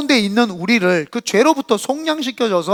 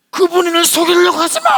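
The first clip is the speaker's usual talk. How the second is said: much higher, louder, strained scream; shaman's angry yell; 10 semitones higher, 6 dB louder than usual. Retold or imitated. Pastor voicing a shaman screaming at a client. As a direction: shriek like a possessed shaman. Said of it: "막 소리 지르고".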